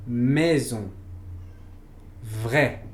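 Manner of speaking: "maison, vrai." with an open French è. Shown as a demonstration. Teacher modeling ai spellings for learners, careful French. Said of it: "Por ejemplo".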